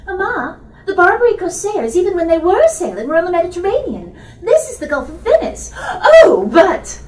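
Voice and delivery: put-on high, loud, hurried young voice; exasperated daughter. Daughter argues geography with her mother, high and quick. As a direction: rattle off the facts, then a laughing break.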